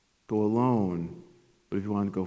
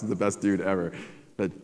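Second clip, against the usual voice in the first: ordinary talk, nothing marked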